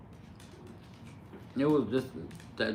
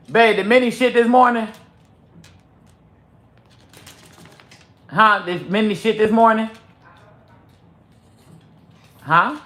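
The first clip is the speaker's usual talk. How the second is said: calling out; 9 semitones higher, 12 dB louder than usual. Real, not acted. Calling to partner in another room.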